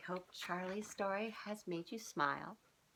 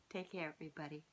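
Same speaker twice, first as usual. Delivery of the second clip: usual speaking voice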